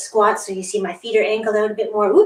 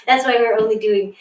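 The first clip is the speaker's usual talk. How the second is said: usual speaking voice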